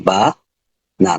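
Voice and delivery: "বা, না" split by a clear pause; teacher's syllable-by-syllable "বা, না"; broken into syllables. Shown as a demonstration. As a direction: sound out "বা", pause, then "না". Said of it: "ভেঙে ভেঙে".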